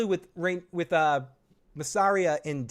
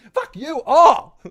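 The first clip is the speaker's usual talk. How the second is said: much higher, louder and slow; hammed-up slow high yell; mock shout; 11 semitones higher, 12 dB louder, slower than usual. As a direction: bellow it like a mock tantrum. Mock outburst ending.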